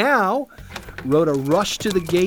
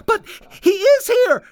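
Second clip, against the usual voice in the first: put-on very high, louder girlish protest; insistent young maid; 6 dB louder than usual. A narrator's reading. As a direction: insist against disbelieving friends. Rhoda argues with disbelieving friends.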